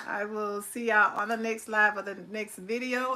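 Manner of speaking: normal talking voice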